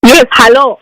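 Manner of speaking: loud on request to be heard; speaking up loudly. Real, not acted. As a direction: project so the council can finally hear. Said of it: "I will try to speak really loud".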